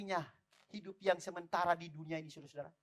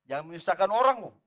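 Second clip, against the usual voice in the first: louder retold warning from a wife; wife's admonishment; 9 dB louder than usual. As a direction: imitate a wife firmly admonishing, voice raised. Retells wife's admonishing reply. (teguran istri yang ditirukan dengan lantang)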